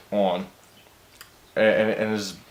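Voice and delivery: usual speaking voice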